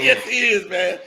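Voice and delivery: loud, high gleeful shout; excited shout. Real, not acted. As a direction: shout it with glee. Gleeful agreement.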